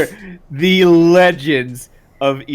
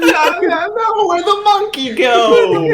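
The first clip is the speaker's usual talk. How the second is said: mock stoner voice; 3 dB louder than usual. Friend imitating the teammate, higher mock voice. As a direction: parrot the high guy. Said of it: "And I'm".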